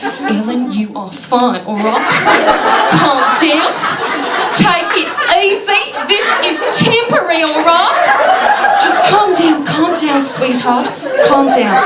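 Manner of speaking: mock soothing Australian accent, rising high. Calming herself down in an Australian accent. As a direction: talk yourself down in a hammed-up Australian accent. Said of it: "speaking to myself in an Australian accent".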